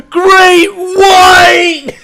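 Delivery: high, loud panicked shriek; mock scream. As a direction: scream it like a terrified camper. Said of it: "they all start screaming".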